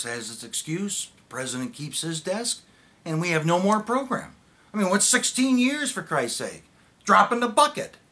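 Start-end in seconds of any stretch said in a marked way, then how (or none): 3.0-6.6 loud and climbing high; bitter outburst. Acted. Facing the end of their program, bitterly loud. Rant about losing the program. 7.0-8.0 high, loud sarcastic dismissal; bitter shout. Acted. Dismissing sixteen years of work, bitterly shouting.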